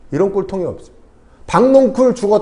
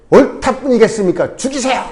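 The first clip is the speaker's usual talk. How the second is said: higher-pitched, acted outburst as indignant Jonah; mock defiant protest; 5 semitones higher than usual. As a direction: play a petulant retort to God, pitch jumping up. 요나가 하나님께 대드는 연기. (높은 목소리로 대드는 요나 흉내)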